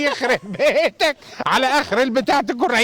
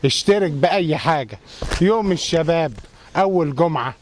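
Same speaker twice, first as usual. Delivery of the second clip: ad read; 7 semitones lower than usual. Acted. Reading ad copy.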